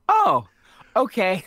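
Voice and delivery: ordinary delivery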